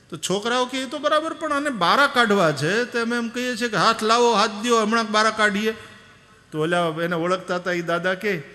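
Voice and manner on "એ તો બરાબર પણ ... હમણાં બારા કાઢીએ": put-on much higher, loud young boys' voice; exasperated youths. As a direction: boys pleading impatiently. Boys explain their attempts. (ઊંચા અવાજે અધીરા છોકરા)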